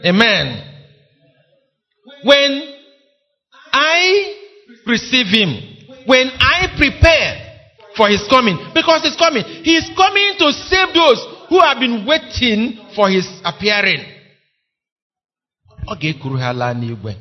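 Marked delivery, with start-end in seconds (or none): 0.1-0.5 louder, hymn words intoned over music; solemn hymn reading; hymn recital. Acted. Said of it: "I'm reading stanza four". 2.2-14.0 fervent preacher's cry; full-force preaching. Acted. Preacher building to climax.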